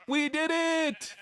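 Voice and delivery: real celebration cry; triumphant cheer. Real, not acted. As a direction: shout it like the game finally broke your way.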